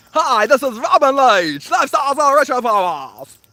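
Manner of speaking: high, put-on British TV host voice; Robin Leach impression. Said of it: "all I know is".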